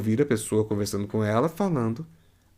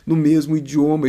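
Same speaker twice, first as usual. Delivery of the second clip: ordinary talk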